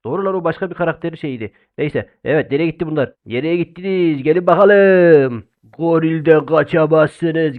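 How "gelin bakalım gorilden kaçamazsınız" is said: menacing villain taunt. Acted. Playing the gorilla villain.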